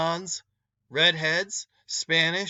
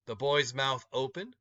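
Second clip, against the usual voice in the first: usual speaking voice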